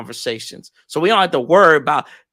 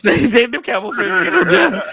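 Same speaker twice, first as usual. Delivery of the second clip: laughing through the joke, pitched up; 7 semitones higher, 4 dB louder than usual. Real, not acted. Laughing while mocking the Cowboy fans. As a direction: crack up mid-line at your own joke.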